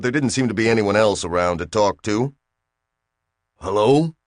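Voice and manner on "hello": hesitant rising hello to nobody; tentative hello. A narrator's reading. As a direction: call out unsure if anyone hears. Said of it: "Bill felt a little silly doing it".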